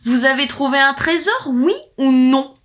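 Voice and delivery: loud, high interrogation, heavy last word; shouted demand. A narrator's reading. Lord questions trembling wife about treasure, shouting. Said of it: "lui cria le Seigneur".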